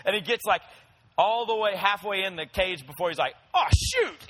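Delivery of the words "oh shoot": much higher, louder sudden oh no; dog's dismay. Pretend. The tricked puppy realizing the cage trap, high and dismayed. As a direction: caught out halfway into the cage. Dog realizes he's trapped.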